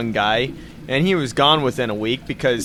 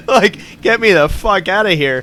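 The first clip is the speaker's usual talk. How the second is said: laughing plea; 6 semitones higher, 4 dB louder than usual. Retold or imitated. Laughing mock plea to escape.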